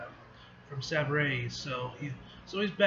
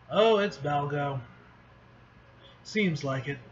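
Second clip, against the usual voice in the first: normal talking voice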